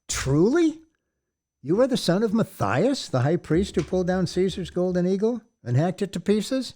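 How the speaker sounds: high, astonished questioning; amazed. Acted. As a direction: marvel at the priest's lineage. Big man amazed by lineage.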